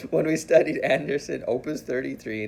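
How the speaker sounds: high, still laughing through the words. Real, not acted. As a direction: giggle through the restart at herself. Restarts sentence after laughing.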